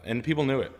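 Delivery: usual speaking voice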